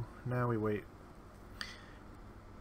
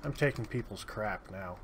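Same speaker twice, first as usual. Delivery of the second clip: ordinary talk, nothing marked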